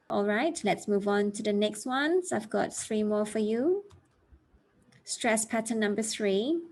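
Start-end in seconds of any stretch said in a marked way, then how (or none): none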